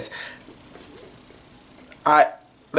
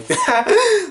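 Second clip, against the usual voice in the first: sudden high burst of laughter; high squeaky giggle. Real, not acted. Laughing at her own silly face, high squeaky giggle. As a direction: let a squeaky laugh escape. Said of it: "I'm not laughing at that".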